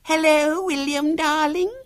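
put-on very high, piercing child voice; shrill, squeaky small girl; shrill little girl. A narrator's reading. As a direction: trill a gushing greeting as a shrill six-year-old. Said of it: "came a shrill voice".

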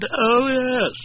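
high, swooping mock-sweet imitation; mimicking the flirt. Wife repeating the caller's words to her husband, mimicking. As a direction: quote her with sugary mockery.

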